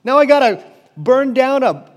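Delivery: high, loud mock monster moaning; a hammed-up sad walking corpse; playful monster whining about being alive. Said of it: "he's like".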